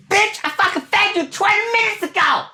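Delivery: squeaky high-pitched mock outrage at the cat; exasperated high shout. Owner scolding the meowing cat, in a high mock-outraged squeal. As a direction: yell it up in a cartoonish falsetto. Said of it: "I'm like".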